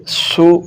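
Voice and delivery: सु with a whistling s; seen sound. Shown as a demonstration. Teacher isolating the mistake, whistled s. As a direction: hiss the s of सु as seen. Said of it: "ये अब सीन की आवाज़ है".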